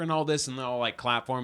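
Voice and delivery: ordinary talk, nothing marked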